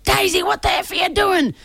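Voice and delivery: high, strained mock yell; imitated angry fan in the stands; hurling abuse. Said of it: "hurled absolute abuse".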